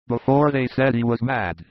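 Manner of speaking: ordinary delivery, nothing marked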